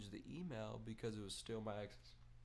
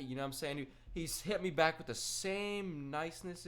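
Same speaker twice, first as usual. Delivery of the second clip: usual speaking voice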